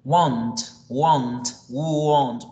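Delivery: "want" drawn out with a clear "o" sound; want said slowly. Shown as a demonstration. Teacher drilling pronunciation, repeating "want" clearly. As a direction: stretch "want", vowel like U then O. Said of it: "es para expresar la palabra querer".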